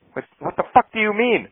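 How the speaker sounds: high-pitched, exasperated comeback; frustrated. Retold or imitated. Frustrated pilot answering the captain's whispered hint, exasperated. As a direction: protest high in disbelief, without shouting.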